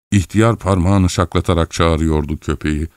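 usual speaking voice

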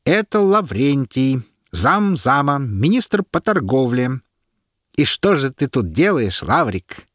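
slow, mocking official tone; herald's mockery. A narrator's reading. Herald taunts the prisoner before the crowd, slow. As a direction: toy with the disgraced minister.

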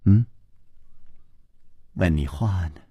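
low, hushed coaxing; tender princess, quiet and low; gentle, soft voice. Acted. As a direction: press softly for an answer. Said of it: "琵琶公主柔声说".

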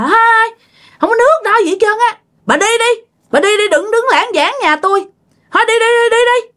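high-pitched, acted-out tirade; imitating angry scolding. Uncle chasing the cloth seller from his house, angry scolding. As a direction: shoo someone off in a high, cross voice. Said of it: "Ổng chửi thấy ghê lắm".

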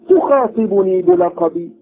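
a lightly voiced haughty prince. Prince quoted, higher and slow.

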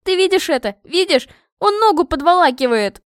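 high, loud, appalled complaint; squealing protest. A narrator's reading. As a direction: squeal in outrage to dad. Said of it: "пищала я".

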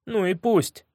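tearful defiance. A narrator's reading. Younger brother defies threat of punishment, mildly tearful. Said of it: "всхлипывал Матео".

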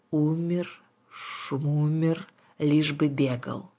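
unbothered, drawled brush-off; dismissive witch. Acted. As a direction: wave it away with a rhyming shrug.

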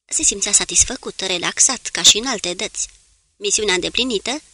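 usual speaking voice

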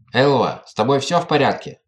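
ordinary delivery

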